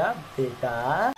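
a lightly voiced toddler, very slow and tender; sweet toddler voice. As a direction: copy her sweet drawn-out baby talk. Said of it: "कितना प्यार से बोलती है". (प्यार से धीरे धीरे बच्ची की नकल)

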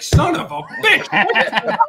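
high, slow, laughing mock groan of dismay; hammed-up imitation of a buddy swearing under his breath; mock exasperated curse. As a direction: lift it way up and drag it out through a laugh. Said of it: "You're like".

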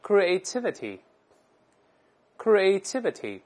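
creativity said slowly and clearly, twice; drawn out for the class to repeat; model reading. Shown as a demonstration. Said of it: "我们大声来念".

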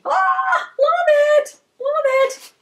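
high squeal of delight; delighted high-pitched cry; excited squeal. Real, not acted. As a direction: squeal with joy at the gift. Opening a gift, squealing.

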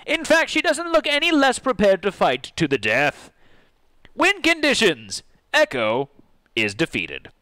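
high-pitched, booming encounter announcement; dramatic announcer. Acted. Game master announcing the final fight, dramatic announcer voice.

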